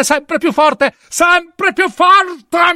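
high shriek, stretched very slow; wild scream. A narrator's reading. Killer breaking down before the officers, screaming "sempre più forte".

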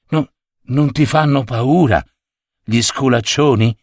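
usual speaking voice

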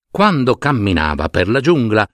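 plain reading voice

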